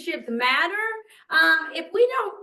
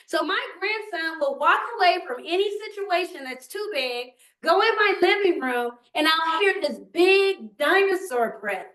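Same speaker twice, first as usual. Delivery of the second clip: ordinary talk, nothing marked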